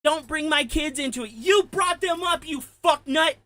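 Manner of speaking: real furious outburst; worked up shout. Real, not acted. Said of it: "But I got worked up right there".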